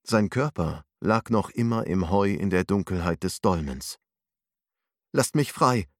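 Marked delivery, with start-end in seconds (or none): 5.1-5.9 panicked shout, louder; shouting in fear from the dark; frightened cry. A narrator's reading. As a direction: cry out in terror to be let out. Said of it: "schrie er erschrocken".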